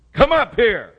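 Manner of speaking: huge high shout from heaven; booming call. Acted. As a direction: bellow it out like a heavenly summons. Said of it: "Woke a couple of people up, I'm sorry".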